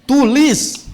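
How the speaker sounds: mock teacher's command to write; stern order. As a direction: snap it at the class like a strict teacher.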